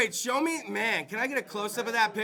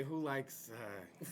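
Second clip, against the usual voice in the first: said far softer and lower, trailing off; muted restart; 10 semitones lower, 15 dB quieter, much slower than usual. Shown as a demonstration. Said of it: "I was about to say".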